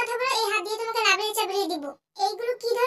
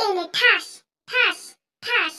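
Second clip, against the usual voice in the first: mock slap sounds; 5 dB louder, slower than usual. Mock slapping with sound words.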